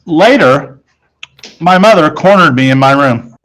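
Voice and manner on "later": ordinary delivery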